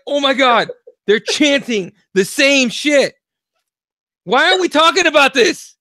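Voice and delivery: high, loud, drawn-out alarm; scared outburst. Retold or imitated. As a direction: shout it in hammed-up teenage panic. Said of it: "never been more scared in my life".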